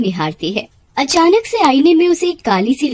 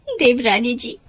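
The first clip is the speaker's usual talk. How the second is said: bitter reproach; 5 semitones higher than usual. Acted. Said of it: "उसे बहुत कोसती है".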